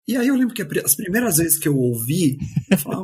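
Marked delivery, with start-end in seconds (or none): none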